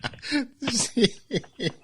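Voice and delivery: a burst of high-pitched laughter. Real, not acted. Host cracks up at the brother story with a high laugh.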